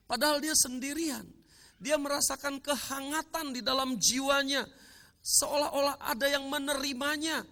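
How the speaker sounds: high, loud, soaring sermon climax; preaching at full force. Acted. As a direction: lift the crowd into the moment of being embraced. Emotional climax of testimony.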